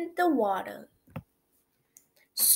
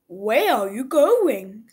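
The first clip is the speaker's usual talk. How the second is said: zebra voice; 10 dB louder than usual. A narrator's reading.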